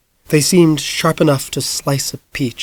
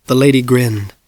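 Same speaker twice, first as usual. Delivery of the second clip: ordinary delivery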